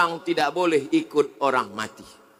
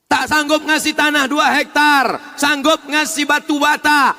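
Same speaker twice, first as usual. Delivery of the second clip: loud, rising sermon delivery; fiery preaching; 8 semitones higher, 4 dB louder than usual. Acted. Preacher warns the congregation they die alone, loud. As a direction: drive it home to the congregation.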